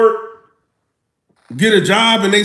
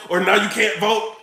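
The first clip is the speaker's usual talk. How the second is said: ordinary talk, nothing marked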